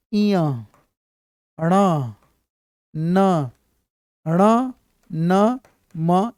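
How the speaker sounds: each letter इया, ण, न, म said slowly and nasally, one by one; reciting nasal consonants like a teacher; nasal letters demo. Shown as a demonstration. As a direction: sound out इया ण न म through the nose, with pauses. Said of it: "ये याद रखना पांच चीज".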